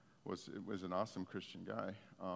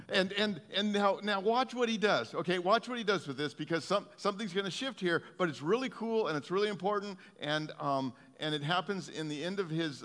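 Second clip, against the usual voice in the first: higher and louder, driving the point home; fervent preaching; 7 semitones higher, 7 dB louder than usual. Acted. Preacher urging the congregation to watch what Jesus does, fervent. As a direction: build the excitement for what's coming.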